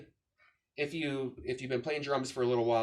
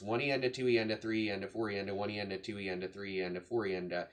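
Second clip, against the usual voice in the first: quick, even syllable count trailing lower; rhythmic sixteenth-note count; 4 semitones lower, 3 dB quieter, much faster than usual. Shown as a demonstration. Drum teacher counting out straight sixteenth notes, rhythmic count. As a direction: rattle off the subdivisions evenly.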